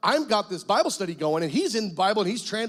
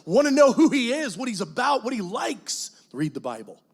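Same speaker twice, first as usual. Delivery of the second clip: earnest, lower exhortation to the congregation; preaching; 4 semitones lower than usual. Acted. Pastor exhorting the congregation to read the Bible. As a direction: urge them to read the Word.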